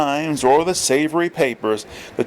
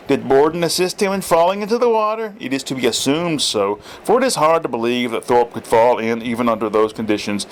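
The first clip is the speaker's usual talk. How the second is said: usual speaking voice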